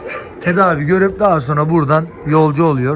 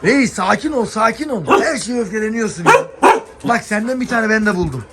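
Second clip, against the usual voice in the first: higher, coaxing pet talk to an angry dog; calming a dog; 6 semitones higher than usual. Real, not acted. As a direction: gently tease the grumpy dog into calming down.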